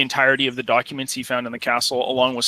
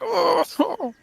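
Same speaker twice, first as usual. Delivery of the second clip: high, drawn-out defeated moan. Acted. Captive overhearing in the back, defeated moan. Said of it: "defeated moan in the back".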